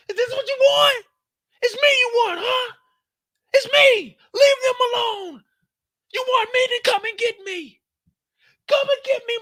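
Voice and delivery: very high, loud, crying defiance; defiant shouting Francis. Retold or imitated. Francis screaming at the sniper to come get him, high crying shout. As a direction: scream for the sniper to take you instead.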